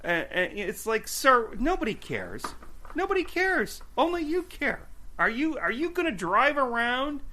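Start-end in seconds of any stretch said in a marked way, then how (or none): 1.1-7.2 high-pitched, incredulous retort; exasperated mock rant. Driver tells off the man over his dented car, exasperated mock rant. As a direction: scold him in exaggerated disbelief.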